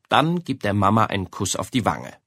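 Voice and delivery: plain reading voice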